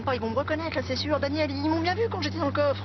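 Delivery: high-pitched, anxious objection; alarmed, trying to stop a friend; panicked protest. Acted. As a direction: plead urgently, voice climbing high. Panicked protest at being recognised.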